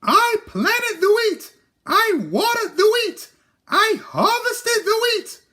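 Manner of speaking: very high squeaky hen voice; little red hen voice. A narrator's reading. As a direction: play a fussy little hen. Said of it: "said the little red hen".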